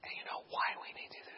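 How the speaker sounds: barely audible, calming whisper; hushed, voiceless explanation. Retold or imitated. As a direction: whisper it confidentially to the patient. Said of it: "we begin whispering to each other".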